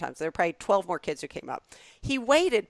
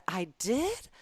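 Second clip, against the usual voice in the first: higher, startled disbelief; surprised; 8 semitones higher than usual. Retold or imitated. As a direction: genuinely caught off guard. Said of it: "And I said".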